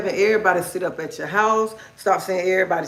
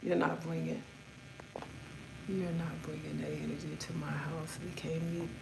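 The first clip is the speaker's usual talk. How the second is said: low firm warning; 4 semitones lower, 19 dB quieter than usual. Retold or imitated. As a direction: lay down the rule quietly. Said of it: "this is exactly how I said it to her".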